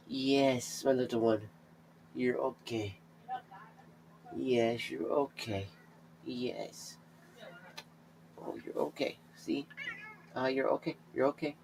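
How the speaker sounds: very soft, hushed reassurance; soothing whisper. Real, not acted. Calming the cat in his arms, hushed soothing. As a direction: gently hush the frightened cat.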